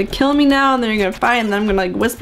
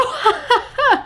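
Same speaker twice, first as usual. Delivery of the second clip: high, hammed-up laughter; high acted cackle; forced prank laugh; 13 semitones higher than usual. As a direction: laugh it off after the gotcha. Acted laugh after fake prank.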